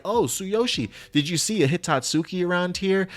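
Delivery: high, eager quoted ally voice; imitating Saru brother. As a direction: pitch up high for a friend's voice. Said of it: "he's like".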